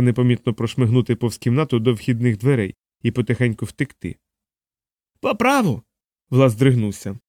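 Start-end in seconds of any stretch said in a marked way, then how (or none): 5.2-5.8 very high, shrieked in rage; enraged man screeching; furious screech. A narrator's reading. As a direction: screech it beside yourself with fury. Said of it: "не кричав, навіть верещав, не тямлячись від люті".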